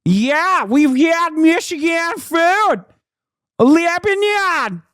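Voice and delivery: high, slow exaggerated twang; mock twang. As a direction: stretch the words out like a hokey local. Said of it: "puts the twang in there".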